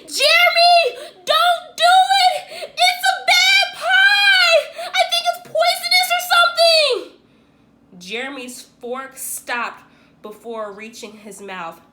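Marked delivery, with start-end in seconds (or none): 0.1-7.2 panicked shout. A narrator's reading. Boy screaming at his friend not to eat the pie, panicked. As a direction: scream the warning to save him.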